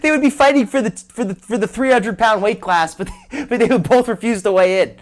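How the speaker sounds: cracking up while finishing the joke; laughing. Real, not acted. Host joking that both fighters refuse weighing in, laughing.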